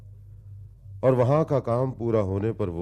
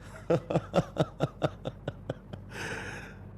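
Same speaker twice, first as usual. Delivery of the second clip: breathy rattling laughter; long mocking laugh; 8 semitones higher, 6 dB quieter than usual. Narrator repeats man and woman, long laugh. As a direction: keep laughing till breath runs out.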